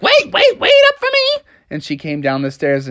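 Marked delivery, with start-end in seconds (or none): 0.0-1.4 very high, squeaky mom voice; Froggy's mom. Acted. Mom calls after the family to wait, high-voiced. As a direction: pitch it way up for an eager mother. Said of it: "his mom was like".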